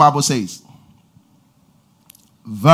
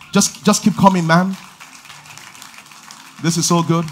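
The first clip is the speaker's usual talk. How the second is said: ordinary talk, nothing marked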